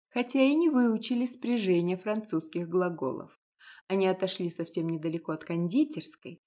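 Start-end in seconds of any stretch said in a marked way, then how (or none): none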